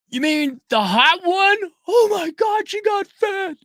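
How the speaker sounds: very high, slow gasp of disbelief; shocked realization. Retold or imitated. Realizing the ex is the hot one, high shocked squeal. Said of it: "And I'm like".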